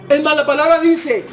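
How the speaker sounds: ordinary delivery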